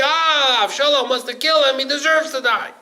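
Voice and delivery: loud, high exclamation of the crowd; acted sudden realization. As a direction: cry it out as if it just dawned. Voices people reacting with sudden realization.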